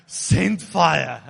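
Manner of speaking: loud, hammed-up cry to the heavens; belted-out mock prayer; mock shouted plea. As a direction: shout it like a frantic worshipper. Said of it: "they need to start shouting".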